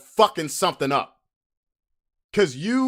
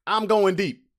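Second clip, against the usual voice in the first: ordinary talk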